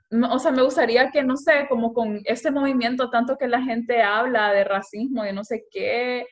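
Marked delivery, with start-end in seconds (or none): none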